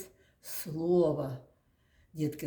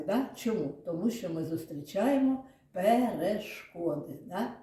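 normal talking voice

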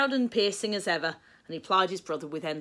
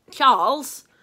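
exasperated; 7 semitones higher, 11 dB louder than usual. A narrator's reading. William with earache interrupts the singing.

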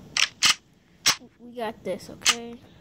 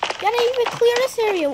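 cover me shout; 11 semitones higher, 6 dB louder, faster than usual. Real, not acted. Urging teammates to cover him.

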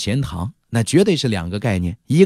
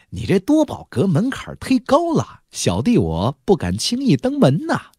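teasing, higher-pitched mock humility; joking; 8 semitones higher than usual. Guest teasing the shop manager as they walk, joking. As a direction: rib the host playfully. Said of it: "又拿为兄开玩笑了".

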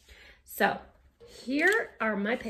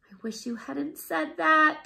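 groaning; 6 semitones higher, 5 dB louder than usual. Acted. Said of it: "Camilla groaned".

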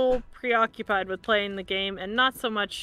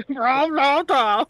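dinosaur voice; 7 dB louder, slower than usual. Pretend. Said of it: "that's also what I sound like".

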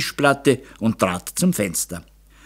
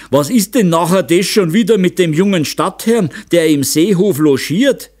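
loud, raised angry questioning; shouted demand; 7 semitones higher, 5 dB louder than usual. A narrator's reading. Father questions daughter about the young gentleman, shouting. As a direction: demand an explanation at high pitch. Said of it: "schrie Meister Zauner".

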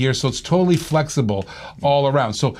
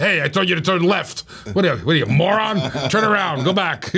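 higher-pitched mock-irate navigator; grumpy, abusive GPS; 3 semitones higher than usual. GPS scolding a driver for a wrong turn, grumpy and abusive. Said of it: "A grumpy, abusive voice".

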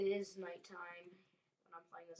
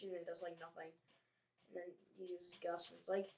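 ordinary delivery

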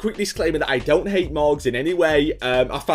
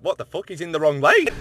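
usual speaking voice

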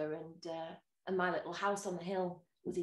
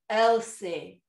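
louder, drawn-out name call; mysterious voice; 8 dB louder than usual. Retold or imitated. The devil calls to the dozing old woman, eerie call. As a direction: call her name like a ghostly visitor. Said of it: "and the voice went".

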